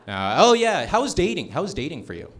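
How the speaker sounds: usual speaking voice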